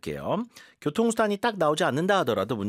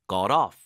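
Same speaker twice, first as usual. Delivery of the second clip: "off" loud and firm; clipped stress drill on "off"; 3 semitones lower, 4 dB louder than usual. Shown as a demonstration. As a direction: punch "off". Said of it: "강세를 어디다 넣어야 되는지".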